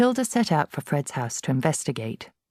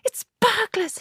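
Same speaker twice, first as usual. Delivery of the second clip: hissed fear. A narrator's reading. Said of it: "hissed the mother".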